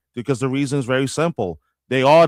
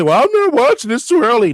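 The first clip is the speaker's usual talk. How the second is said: high, louder mock excuse; higher-pitched pretend protest; mock complaining fan; 6 semitones higher, 5 dB louder than usual. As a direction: lightly imitate a fan making excuses. Mimics reluctant fan's excuse.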